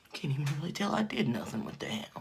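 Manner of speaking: unvoiced whispered aside. Real, not acted. A whispered aside to viewers during makeup. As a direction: breathe it under your breath.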